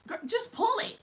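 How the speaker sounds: put-on high, pushy girlfriend voice; mock high Hazel voice. Mocking Hazel telling me to pull it. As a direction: pitch it way up, bossy and impatient. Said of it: "This is her".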